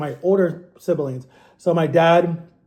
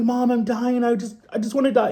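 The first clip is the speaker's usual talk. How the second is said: put-on whiny sick kid; 6 semitones higher than usual. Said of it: "be like".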